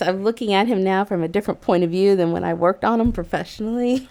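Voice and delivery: ordinary delivery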